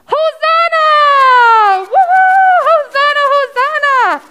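long high shout, then whoops; jubilant crowd cheer. Acted. Crowd hailing Jesus, joyful shouting.

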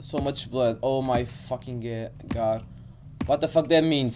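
ordinary delivery

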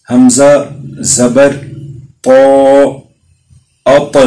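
اط with the ط bounced, a slight echo after the stop; qalqalah bounce. Shown as a demonstration. Teacher showing students the qalqalah echo on اط. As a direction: let the ط in اط spring back with a light jolt. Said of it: "یہ جو ہلکی سی جنبش لفظ میں اط".

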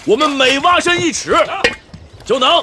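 high-pitched call to the diggers; rally the men like a drill leader; shouting slogans. Acted. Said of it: "你现在都会喊口号了". (高声喊口号给大家鼓劲)

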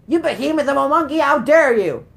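fast, high, loud outrage; hammed-up indignant yell; mock indignation. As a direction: rattle off an affronted shout at the monkey. Scolds the game's monkey.

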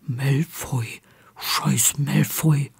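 low, hushed near-murmur. A narrator's reading. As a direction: drop the voice down, keep it secret. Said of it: "Ron senkte seine Stimme".

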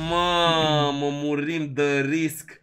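very low, slow, stretched-out lament; dejected groan. Real, not acted. Streamer laments a failed gamble, dejected drawl. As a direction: drag each word out low in defeat. Groans after the upgrade fails.

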